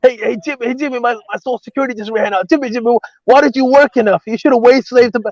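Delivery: put-on high, whiny old man; grumbling out of touch boomer. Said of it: "boomer voice".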